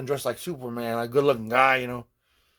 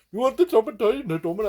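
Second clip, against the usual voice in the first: higher imitation of a Trinidadian accent; 11 semitones higher, 4 dB louder than usual. A patient imitates his Trinidadian dentist's accent. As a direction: mimic the Trinidadian dentist's lilt. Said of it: "this woman from Trinidad".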